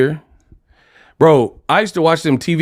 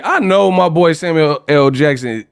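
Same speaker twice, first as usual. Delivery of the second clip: usual speaking voice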